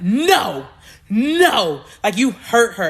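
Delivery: loud, emphatic retold "no"; protective scolding. Crying friend points at groom, protective scolding. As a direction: warn him off firmly, punching each no.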